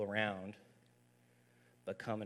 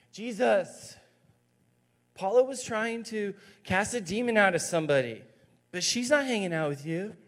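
whiny voice; 11 semitones higher, 8 dB louder than usual. Acted. Disciple voiced whining to Jesus.

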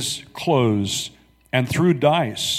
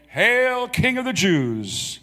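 high, slow sneering salute to a fake king; mocking hail; 10 semitones higher, slower than usual. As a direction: hail him like a jeering soldier. Soldiers mocking Jesus with salute.

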